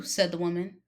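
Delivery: ordinary delivery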